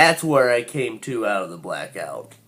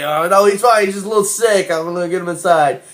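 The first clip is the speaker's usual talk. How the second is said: higher, louder, fast nervous reassurance; nervous friend covering; 6 semitones higher, 5 dB louder, faster than usual. Retold or imitated. Friend assuring the cops he's fine, nervously higher. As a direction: talk the cop down in a rush. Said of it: "It's like".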